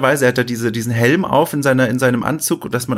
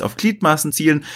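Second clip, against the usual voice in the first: ordinary talk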